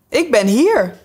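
a voice calling up loudly from the soup tureen; muffled call from a soup tureen. A narrator's reading. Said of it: "riep een stem uit de soepterrine".

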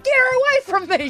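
high, mock-desperate cry for help; mock panicked plea. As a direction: beg the uncle to save you, hammed-up. Speaker pleads to uncle, cornered.

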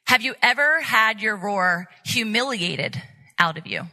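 normal talking voice

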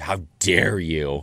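slow, hammed-up how dare you; mock outrage. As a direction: play it overdramatic. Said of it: "you're like".

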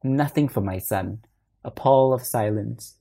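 ordinary delivery, nothing marked

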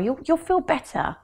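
mum's soothing line. Retold or imitated. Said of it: "She was like".

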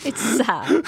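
helpless laugh breaking into the words. Real, not acted. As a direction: say it while cracking up. Laughing at the husband's hobby.

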